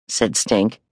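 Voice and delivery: plain reading voice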